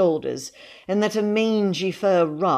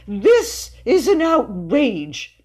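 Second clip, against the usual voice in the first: slow, high, loud indignation; pompous outraged declaration; spluttering outrage; 8 semitones higher, 5 dB louder, much slower than usual. A narrator's reading. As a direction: protest with every word stretched. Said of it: "he spluttered".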